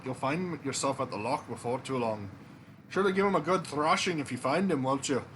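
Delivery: put-on untrained movie Scottish accent for a king; a bluff Scottish king, in a movie Scottish accent. King recruiting the hero Jack, in a Scottish accent. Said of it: "my Scottish accent".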